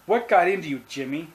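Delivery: narrated sharp, upset questioning; demanding. A narrator's reading. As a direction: demand an answer like a cross mother. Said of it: "she demanded".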